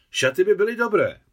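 high, loud embarrassed answer; embarrassed reply. A narrator's reading. Bride answering the groom about the dress, embarrassed and high. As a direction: caught off guard, embarrassed. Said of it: "rozpačitě odpověděla Anet".

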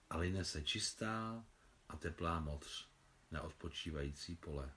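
softly recited autumn verse, fading at line ends; quiet lyrical recital slowing at the close; poem recitation. Acted. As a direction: declaim the poem gently, letting it die away. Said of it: "Zakončila deklamaci veršů Sašenka".

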